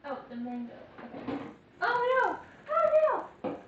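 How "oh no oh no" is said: high, loud oh no; dismayed cry. Real, not acted. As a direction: cry out at the spill. Mango spill.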